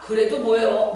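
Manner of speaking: usual speaking voice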